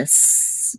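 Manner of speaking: a long sustained hiss on "S"; held S sound. Shown as a demonstration. Teacher isolating the doubled letter, held S sound. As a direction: draw out "S" as a pure sibilant. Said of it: "just hold it a little bit".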